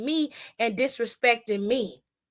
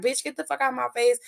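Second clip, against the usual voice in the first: fast, mocking brush-off, voiced as Shayna; Shayna telling her off; faster than usual. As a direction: spit it out quick at her rival. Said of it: "Shayna Baszler was like".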